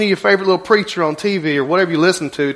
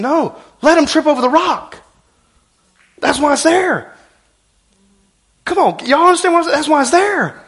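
fervent preaching; 8 semitones higher than usual. Real, not acted. Preacher rising to full force.

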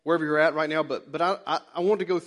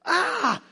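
sudden high yelp of surprise; retold startled yelp; 13 semitones higher, 3 dB louder than usual. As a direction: yelp like something just hit your eye. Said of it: "she just, like, freaks out".

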